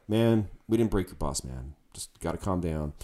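calm bass player. Retold or imitated. Bass player calmly telling the enraged singer to calm down. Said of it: "He was like that".

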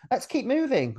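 high, chirpy-pitched mock urging. Voices the film's recurring urging.

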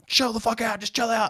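high, strained imitation of an angry brother; exasperated mock yell. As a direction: imitate ordering someone to calm down mid-scuffle. Brother holding him in a headlock.

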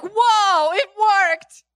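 the enemy cheering that his trick worked; gleeful enemy. Acted. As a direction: crow with delight like a scheming devil. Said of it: "He's like".

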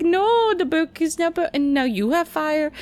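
high-pitched, exasperated retelling; hammed-up high "no", easing down slightly; mock outcry. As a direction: wail the reaction in comic dismay. Acts out reaction to movie scene.

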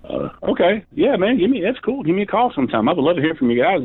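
high, sing-song fake friendly voice; high mock-polite phone voice; put-on cheery voice. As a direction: play it overly sweet.

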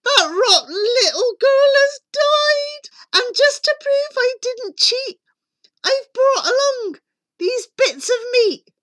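very high, loud huntsman's report; over-the-top servant shouting to the queen; crying out. A narrator's reading. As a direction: proclaim the news in a strained high cry. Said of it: "he cried".

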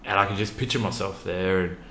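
ordinary talk

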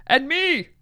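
high-pitched mock shout; imitated shout. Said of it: "He would have shouted".

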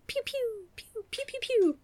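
high, soft cartoon projectile noises; little squeaky imitated shots; arrow sound effects. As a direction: pew out tiny high-pitched arrows.

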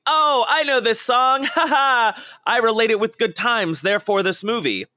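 high-pitched, gleeful parody of moviegoers; hammed-up excited fan; mock audience reaction. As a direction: imitate an easily pleased moviegoer, laughing along. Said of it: "you want people to be like".

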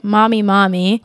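normal talking voice

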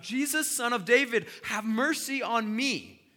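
high, full-force shout, stretched at the end; loud, desperate plea across a crowd; yelling out. Acted. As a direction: yell it out without caring who looks. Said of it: "He yells out".

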